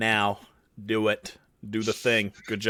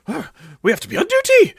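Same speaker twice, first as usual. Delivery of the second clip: high, fast, louder panicked guards; high hurried guard voice; flustered guards; 12 semitones higher, 6 dB louder, faster than usual. Acted. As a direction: scramble up flustered and rushed. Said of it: "they're like".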